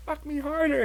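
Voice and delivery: high, soft imitated demand; half-whispered high imitation; mock breathy demand. Quoting partner.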